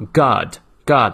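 God with American open unrounded vowel, "gahd"; American pronunciation. Shown as a demonstration. English teacher showing students American God, open "ah" vowel. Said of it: "美音的时候呢，是倒三角的啊".

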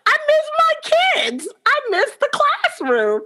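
put-on high, slow wistful whine of missing the kids; mock longing. To herself mid-summer, a high drawn-out longing whine. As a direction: ache for the classroom, drawn out and high. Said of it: "I'm like".

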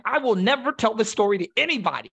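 put-on high, loud, indignant teenage vow; defiant teen vow. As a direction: fling it out like a stubborn kid. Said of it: "And I'm like".

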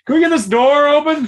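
high, loud frustrated call; shouting. Acted. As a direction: yell for the door to open. Yelling over door.

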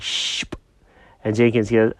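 ordinary delivery, nothing marked

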